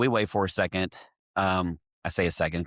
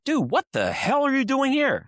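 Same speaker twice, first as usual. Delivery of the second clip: excited disbelief. Retold or imitated. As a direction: blurt it out like a stunned comedian. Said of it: "He stops the show and is like".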